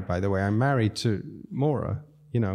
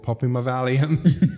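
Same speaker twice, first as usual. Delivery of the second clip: usual speaking voice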